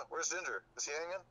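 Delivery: ordinary delivery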